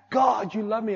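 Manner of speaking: loud, high cry to God; retold joyful shout. Worshipper crying out to God, joyful shout. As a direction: shout it out as if reliving the moment. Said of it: "I just began to shout out".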